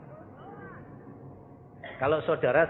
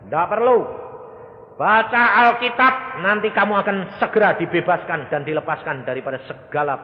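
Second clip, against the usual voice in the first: loud instruction; 5 semitones higher, 10 dB louder than usual. Retold or imitated. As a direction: command her firmly. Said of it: "saya ya bilang". (perintah tegas dan keras)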